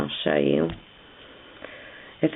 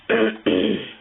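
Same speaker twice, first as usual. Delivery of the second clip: rough throat clearing; 6 semitones higher than usual. Real, not acted. Teacher with a sick voice clearing her throat. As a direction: clear a sore throat before going on. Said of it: "Sorry, and my voice is going".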